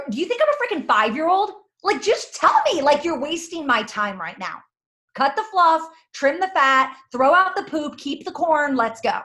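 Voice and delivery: hammed-up exasperation, cut to the chase; impatient mock rant. A red green insulted by the fluff, impatient mock rant.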